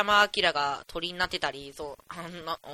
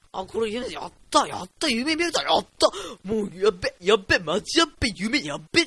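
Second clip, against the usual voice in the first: louder, tumbling cries of glee; can't contain the joy of dreaming; giddy excitement; 5 dB louder than usual. Retold or imitated. Finally dreaming on purpose, giddy and loud. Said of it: "みたいな感じで".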